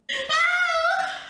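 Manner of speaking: excited high-pitched laugh; high laughing squeal. Real, not acted. Streamer about to start the video, squealing laugh.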